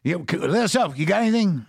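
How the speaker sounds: pleading over the buzzer. Retold or imitated. Sam begging Greg over the intercom, high and loud. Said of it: "And Sam's like".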